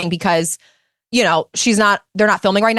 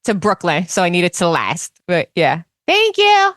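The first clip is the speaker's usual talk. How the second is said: Teresa Giudice impression. Teresa ordering iced coffee at Dunkin', Jersey accent. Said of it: "Teresa Giudice ordering a cup of coffee".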